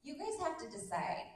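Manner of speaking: ordinary talk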